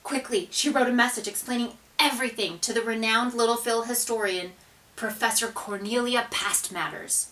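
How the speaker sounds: ordinary delivery, nothing marked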